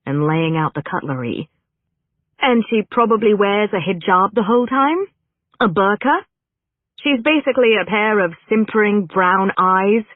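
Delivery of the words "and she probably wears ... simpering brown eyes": higher, indignant run of jabs; scornful guessing. A narrator's reading. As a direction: pile up the guesses with mounting scorn. Actress scornfully guesses the stereotyped role.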